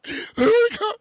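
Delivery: high, loud burst of laughter; cracking up at his friend's word; bursting out laughing. Real, not acted. Friend laughs out loud at the odd word. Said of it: "Say it again".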